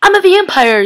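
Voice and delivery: high, loud mock outburst; blurted confession. Elena telling Liam her secret, blurted loudly.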